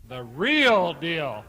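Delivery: slow dramatic announcer drawl; announcer intro. Acted. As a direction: draw out the name like a ring announcer. Ring introduction, drawn out.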